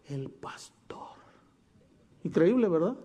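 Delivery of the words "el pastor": a secret punchline, barely voiced; whispered reveal. Shown as a demonstration. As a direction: whisper "El pastor" slowly, almost breathless. Reveal of who came forward.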